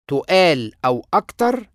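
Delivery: ordinary talk, nothing marked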